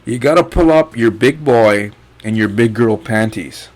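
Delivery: normal talking voice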